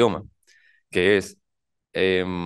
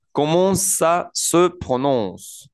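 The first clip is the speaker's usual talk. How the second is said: slow and higher, small gaps between words; 5 semitones higher, much slower than usual. Shown as a demonstration. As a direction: spell the phrase out word by word. Teacher breaks the phrase down.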